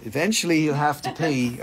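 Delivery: ordinary delivery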